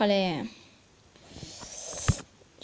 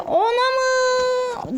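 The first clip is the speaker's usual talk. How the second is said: shocked cry; 13 semitones higher, much slower than usual. Real, not acted.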